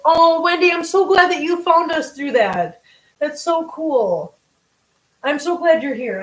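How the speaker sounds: high, loud burst of gladness; delighted gushing. Real, not acted. Streamer welcomes Wendy who found the channel, delighted.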